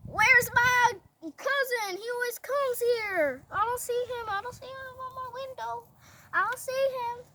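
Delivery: high-pitched, plaintive mock voice; worried Esther. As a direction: play a queen fretting over a missing cousin. Esther looks for cousin.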